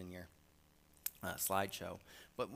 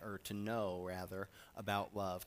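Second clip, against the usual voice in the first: ordinary delivery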